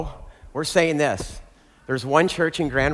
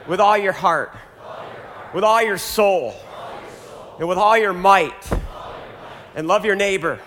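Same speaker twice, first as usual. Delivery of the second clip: loud, lifted liturgical proclamation; leading call and response; 5 semitones higher, 3 dB louder than usual. Real, not acted. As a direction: call out each line, leave room for the echo. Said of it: "Repeat after me".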